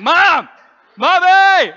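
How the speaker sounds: put-on very high, loud kid's cry for help; yelling for mom. Sixth grader stuck on the field calls to mom, yelling. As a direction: yell it like a helpless child. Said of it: "just started yelling".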